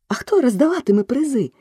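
високо й жваво, наче за цілий гурт; спитай високим голосом за всіх; питання від хору. A narrator's reading. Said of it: "запитав цілий хор голосів". (високе здивоване запитання)

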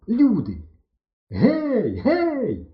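high-pitched calling for people; excited high hail; crying out. A narrator's reading. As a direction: call out for help into the distance. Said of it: "скрикнули ми і замовкли".

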